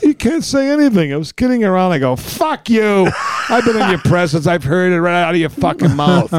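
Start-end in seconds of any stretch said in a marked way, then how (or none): 0.0-1.9 high-pitched imitation of whining backpedal; high, indignant mock excuse; mock protest. Relative protesting in retold quarrel. 2.2-6.3 raised-pitch outburst, re-enacted; acted-out indignant comeback, starting high; retold angry retort. Acted angry retort in family quarrel.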